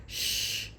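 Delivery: soft voiceless shh; hushing shh. Pretend. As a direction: hush the listener gently. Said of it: "Be quiet, be still".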